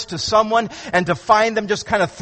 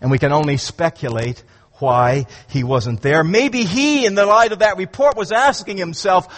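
normal talking voice

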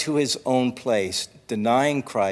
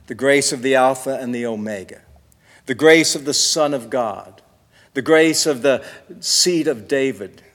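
ordinary talk

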